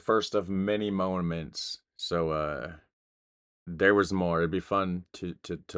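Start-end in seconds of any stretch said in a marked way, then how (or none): 0.0-1.8 slow, weighted reread of "First of many moments"; slow pointed quote. Retold or imitated. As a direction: lean on "First" and draw out "First of many moments" slowly. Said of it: "She said".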